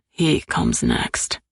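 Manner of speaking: slow, low, certain man's voice; low male voice. Acted. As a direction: drop deep and slow for him. Anton voiced by female narrator.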